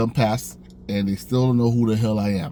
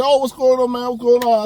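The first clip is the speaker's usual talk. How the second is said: high, loud, friendly hey-man greeting; high excited bro voice; hyped coworker greeting; 10 semitones higher, 5 dB louder than usual. Retold or imitated. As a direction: greet the new guy like an old buddy. Coworkers greet the new guy.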